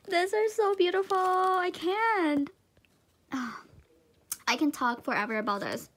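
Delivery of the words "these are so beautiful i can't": high-pitched gushing after a laugh; overwhelmed, near tears. Real, not acted. Fan overcome by beautiful pins, tearful gushing. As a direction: gush high, as if about to cry. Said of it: "I'm gonna cry".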